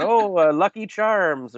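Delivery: a quick mock Irish lilt, drawn out; mock Irish accent. Teasing an Irish comic, mock Irish accent. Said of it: "I made fun of his accent".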